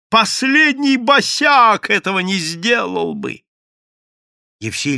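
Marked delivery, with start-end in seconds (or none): 0.1-3.4 high, loud, drawn-out outrage; straining shout. A narrator's reading. Madame publicly shaming the runaway father, straining shout. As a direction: wail the reproach at the crowd. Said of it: "надрывалась мадам Безлюдная".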